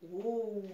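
"O" held long and round; rounded vowel. Shown as a demonstration. Teacher naming the round-mouth letter, held vowel. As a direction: round the lips and sustain "O". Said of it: "qui fait un rond dans la bouche".

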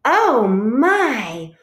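high, stretched-out exclamation; quacking Duck. A narrator's reading. Duck quacks to Goose on finding something, high-pitched astonishment. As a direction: ask it high and loud, drawn out. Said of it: "Duck quacked".

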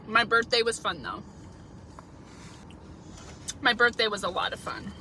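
talking between bites of salad; words muffled by food; mouth full, chewing. Real, not acted. Seen 0: Takes forkful at 3.3, then talks with jaw chewing through the end.

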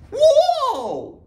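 very high, drawn-out whoa; amazed whoa. Acted. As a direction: gasp in wonder. Amazed exclamation.

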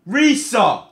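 high, loud cry of the name; urgent shout. Real, not acted. Fan calls to his player, urgent shout. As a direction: yell out to a player mid-match.

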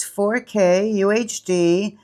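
ordinary talk

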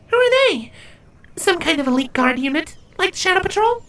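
a shy outsider asking her friend; very high, curious little creature; Tibia, curious changeling. Acted.

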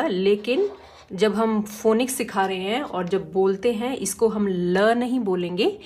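ordinary talk, nothing marked

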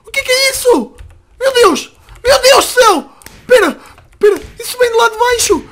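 high, loud startled panic; alarmed. Acted. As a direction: cry out in sudden alarm. Startled awake by noise, acted scene.

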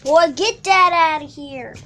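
loud, scolding shout. Real, not acted. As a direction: yell it at the screen. Shouting at the game.

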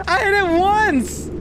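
squeaky giggly denial; high laughing protest. Real, not acted. Defending while laughing.

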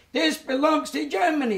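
high, loud mock ranting dictator; Hitler ranting. Survivor imitating Hitler raving on the radio, high loud rant. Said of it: "he started raving about on the radio".

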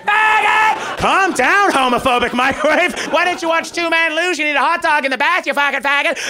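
high, loud, cartoonish bigoted rant; ranting microwave. Comic voicing a homophobic microwave shouting slurs. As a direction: yell it like an unhinged appliance.

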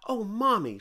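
little girl pleading with her mom, lightly voiced. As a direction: call to mommy like an excited child. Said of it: "she said".

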